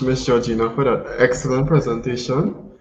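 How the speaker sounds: usual speaking voice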